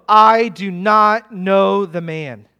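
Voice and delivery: slow, loud, deliberate oath; worked-up denial. Acted. Peter denying Jesus with an oath, worked up and emphatic. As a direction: hammer out each word firmly. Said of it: "But Peter's worked up now".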